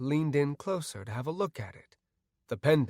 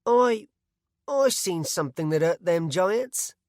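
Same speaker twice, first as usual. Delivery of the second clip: nervous, higher, hesitant villager; nervous stammer; 6 semitones higher, 6 dB louder than usual. A narrator's reading. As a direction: stumble out the words nervously. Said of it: "wrung his hands nervously".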